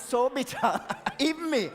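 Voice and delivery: amused laugh breaking into the words. Real, not acted. Admitting to the audience how bitter it is, laughing. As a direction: say it through a laugh, voice lifting. Laughing at own chocolate confession.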